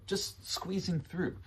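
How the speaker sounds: high, slow, friendly sing-out; cute and non-confrontational; almost cute. Shown as a demonstration. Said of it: "these phrases sound very, uh, almost cute".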